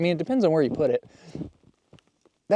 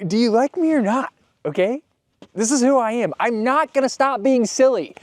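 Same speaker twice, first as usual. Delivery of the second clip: defiant retort; 7 semitones higher, 5 dB louder than usual. Retold or imitated. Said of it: "And I'm like".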